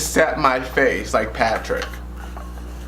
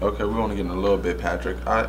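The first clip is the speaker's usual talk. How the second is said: rushed mutter; 5 semitones lower, 5 dB quieter, much faster than usual. Real, not acted. Rushed, hushed while doing makeup.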